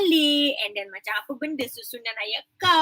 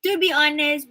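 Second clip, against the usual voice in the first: usual speaking voice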